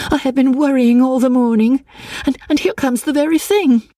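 high, worked-up urgency; overwrought, high-pitched outburst; agitated cry. A narrator's reading. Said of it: "she cried".

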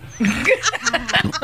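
high wheezy laugh after choking. Real, not acted.

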